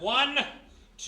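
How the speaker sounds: high, loud desperate count. Acted. Jimmy counts down to jump, loud and high. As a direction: count off before the leap.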